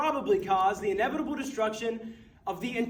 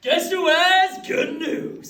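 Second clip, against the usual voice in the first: put-on slow, loud, high teen boy; eager kid voice; 9 semitones higher, 10 dB louder, much slower than usual. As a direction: burst in as an eager schoolboy. Son enters in kid voice.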